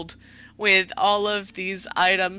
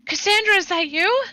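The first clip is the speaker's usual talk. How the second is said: high-pitched, louder eager question; excited call; 12 semitones higher, 4 dB louder than usual. Acted. Althea rushing out to find Cassandra, excited. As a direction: call out in delighted surprise, voice jumping up.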